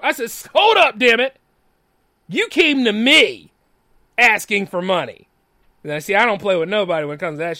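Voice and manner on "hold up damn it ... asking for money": retold indignant outburst. Retold argument with the woman.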